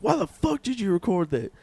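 high-pitched imitation of a gamer rage; mock angry outburst. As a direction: whine it high, imitating an outraged streamer. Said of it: "he broke his controller".

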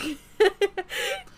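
high burst of laughter; loud laugh. Real, not acted. Laughing at the joke.

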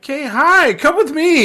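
high-pitched imitation of a hurried hello; mock urgent greeting. Acting out Eleanor greeting Kate hastily.

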